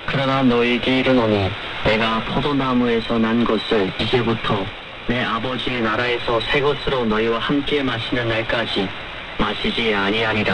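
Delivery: ordinary talk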